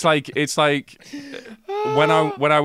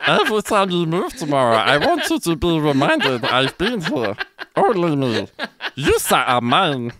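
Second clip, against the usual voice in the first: giggly, goofy cartoon alien seduction; silly alien voice, laughter leaking in; Jar Jar Binks; 3 semitones higher than usual. Retaking a possessive lover's line after giggling, goofy Jar Jar impression. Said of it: "I fucking hate that voice".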